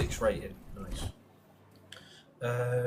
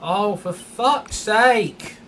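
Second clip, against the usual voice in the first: slow, drawn-out angry shout, much louder; furious yell; 6 semitones higher, 7 dB louder, much slower than usual. Real, not acted. Gamer furious at the race game, angry shout. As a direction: bellow it out in frustration.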